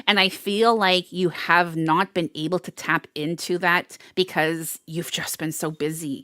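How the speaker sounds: ordinary delivery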